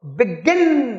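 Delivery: Begin with a strong, falling गिन; teacher's demo of begin, weight on गिन; stress on second syllable. Shown as a demonstration. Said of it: "गिन पे जोर है".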